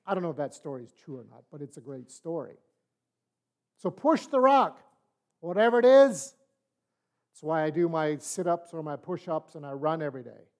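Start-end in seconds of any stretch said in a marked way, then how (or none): 3.8-6.3 emphatic exhortation. Real, not acted. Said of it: "preacher exhorts congregation".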